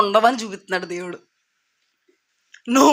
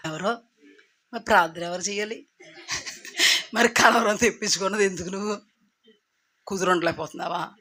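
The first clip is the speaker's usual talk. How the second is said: scolding pastor; 2 semitones lower, 6 dB quieter, faster than usual. Acted.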